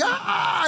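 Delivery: chillido agudo fingido, sin fuerza; grito imitado. Retold or imitated. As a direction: remedo suave del grito de una multitud. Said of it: "la gente gritaba". (gritito agudo de susto fingido)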